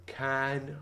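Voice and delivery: usual speaking voice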